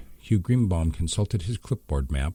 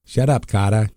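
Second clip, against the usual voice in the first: much louder barked yell; 5 semitones higher, 7 dB louder than usual. Acted. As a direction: yell him down. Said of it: "yet another soldier yelled".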